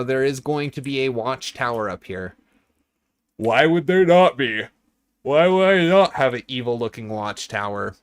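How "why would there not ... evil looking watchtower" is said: louder, mock-outraged rhetorical question; mock indignant. Streamer to chat about his watchtower, hammed-up indignation. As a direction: act offended that anyone would doubt it.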